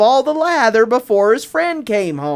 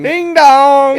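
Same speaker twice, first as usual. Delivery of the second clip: sing-song ding dong like a bell; bright two-note doorbell call; doorbell chime; 4 semitones higher, 6 dB louder than usual. A narrator's reading. Doorbell sound in story.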